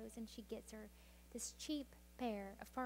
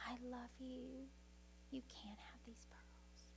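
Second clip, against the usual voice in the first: slow, softer, through tears; tearful whisper; 9 dB quieter, slower than usual. A narrator's reading. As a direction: whisper it while crying. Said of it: "as she cries".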